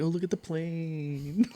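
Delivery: high, soft, drawn-out singsong; mock pickup line. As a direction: dangle a bad pickup line, slow and soft. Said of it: "You're out there trying pickup lines".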